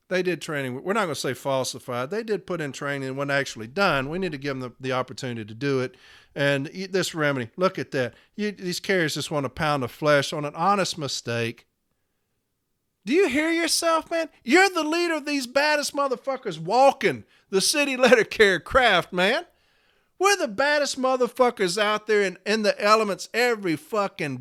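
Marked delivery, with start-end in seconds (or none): 0.1-11.6 lightly voiced official, low and subdued; mocking management-speak. Member mimicking union leader sounding like management, mocking. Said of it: "That's what you sound like". 13.0-21.8 high-pitched indignant challenge; incredulous outrage. Real, not acted. Member confronting union leader after mimicking him, outraged.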